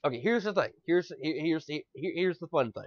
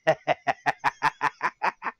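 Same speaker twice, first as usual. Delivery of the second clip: Joker laugh. Shown as a demonstration. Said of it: "a Joker laugh".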